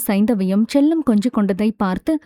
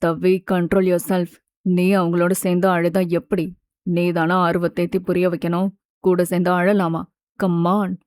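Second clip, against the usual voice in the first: calmly firm man; 5 semitones lower than usual. A narrator's reading. Mithul telling crying Thavi to control herself, calm and firm.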